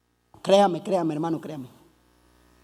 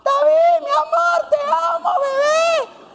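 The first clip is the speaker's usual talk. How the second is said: screaming fangirls; slower than usual. Retold or imitated. Girls outside the palace calling up to David, shrieking fangirl squeal. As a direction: shriek it like a teenage girl at a balcony. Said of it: "el pueblo se regocijaba y gritaban".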